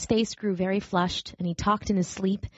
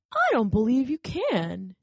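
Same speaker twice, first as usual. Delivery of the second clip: doubtful wild rabbit; 4 semitones higher, slower than usual. A narrator's reading. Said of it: "he said".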